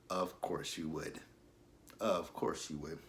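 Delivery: low, slow sarcastic mutter. Pretend. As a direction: roll your eyes and mutter it.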